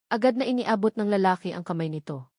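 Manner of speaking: ordinary delivery, nothing marked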